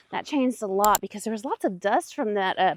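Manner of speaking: ordinary talk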